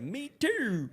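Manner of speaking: high-pitched mimicked Baltimore "me chew"; hammed-up Baltimore catchphrase; strong Baltimore accent. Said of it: "that goddamn accent is so strong".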